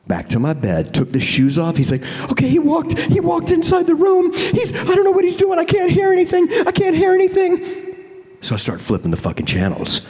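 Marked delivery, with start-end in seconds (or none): 2.3-7.9 high, loud mock fear; high frightened report; panicked friend. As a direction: yelp it scared.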